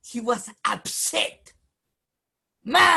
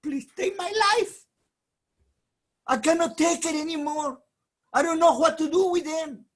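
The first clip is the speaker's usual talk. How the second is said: high, loud begging; louder, higher anguished plea; desperate plea; 7 semitones higher, 5 dB louder than usual. Acted. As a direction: beg to be released, exhausted. Moshe begging God for death.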